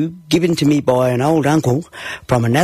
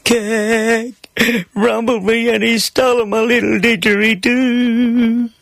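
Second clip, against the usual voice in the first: put-on higher, slower sobbing little boy; weeping child; 10 semitones higher, slower than usual. A narrator's reading. Little Johnny telling Mum through sobs, weeping child voice. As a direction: get it out between sobs. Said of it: "Between my sobs, I managed to weep".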